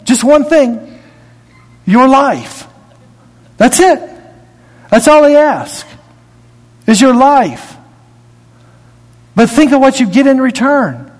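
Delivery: high, pressing sermon voice, driving each point home; rising pulpit fervor; fired-up preaching. Acted. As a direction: preach it out to the congregation. Preaching at full force.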